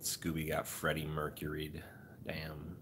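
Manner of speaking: hushed joke under breath; whispered. Real, not acted. Streamer whispering a dark joke to chat. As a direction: whisper it aside.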